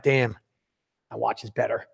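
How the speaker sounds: low, quiet aside to oneself; grudging admission. Acted. Would-be buyer after seeing the nicer watch, grudging admission.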